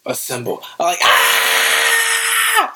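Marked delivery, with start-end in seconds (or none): 0.0-0.6 low, soft heroic call; deep hero voice. Retold or imitated. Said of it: "I'm screaming the". 1.0-2.7 very high excited scream; theater scream. Retold or imitated. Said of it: "I'm screaming".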